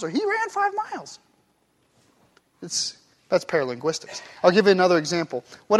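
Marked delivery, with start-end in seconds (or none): 0.1-1.2 "five" jumps high, falling after; a high, soft incredulous "five"; he ran FIVE miles?; stress on five, high. Shown as a demonstration. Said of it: "well, is it".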